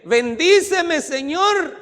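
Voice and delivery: loud, high cry to God; błagalne wołanie. Acted. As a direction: plead at full voice as Jacob begging a blessing. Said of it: "Jacob empieza a decirle".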